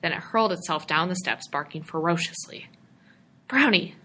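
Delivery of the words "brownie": loud call of the dog's name; shout. A narrator's reading. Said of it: "George shouted".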